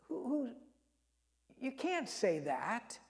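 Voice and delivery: high, slow, soft scandalised protest; shocked objection. Acted. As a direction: gasp it out as a shocked scribe. Scribes object to forgiving sins.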